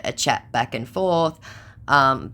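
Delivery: normal talking voice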